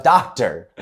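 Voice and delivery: calling up. Retold or imitated. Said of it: "he shouts up".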